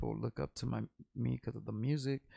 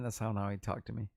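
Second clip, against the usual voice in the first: ordinary talk, nothing marked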